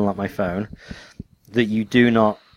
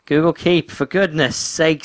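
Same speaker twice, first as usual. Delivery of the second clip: higher, emphatic exasperation; exasperated; 6 semitones higher, slower than usual. Real, not acted. Co-host explaining Keep to friend, exasperated. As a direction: spell it out with mock impatience.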